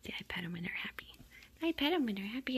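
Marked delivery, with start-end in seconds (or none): 1.6-2.6 higher, cooing sing-song; pet talk. Real, not acted. Petting happy kittens, higher cooing pet talk. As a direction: coo it to the kittens, pitched up.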